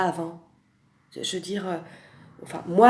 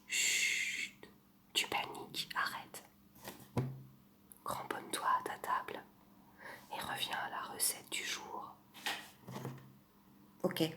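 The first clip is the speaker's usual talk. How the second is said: soft close-mic whisper, right in your ear; ASMR whisper; 3 semitones lower, 11 dB quieter than usual. Pretend. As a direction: breathe every word into the mic like an ASMR video. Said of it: "je vais vous faire une imitation".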